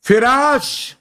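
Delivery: normal talking voice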